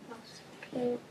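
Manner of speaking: soft pretend whisper; hushed, secretive reply to the hunter; hushed hiding voice. Hiding from vampire in story.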